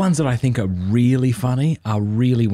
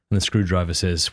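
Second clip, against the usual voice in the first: normal talking voice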